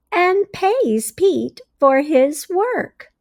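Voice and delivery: bright, expressive storybook read-aloud; reading to children. A narrator's reading. Teacher reading a story to her class, expressive read-aloud. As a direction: read it like a teacher sharing a picture book.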